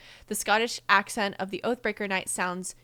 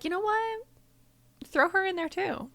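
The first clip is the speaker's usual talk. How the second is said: high-pitched quip; 11 semitones higher than usual. Acted. Acting out own easy attraction.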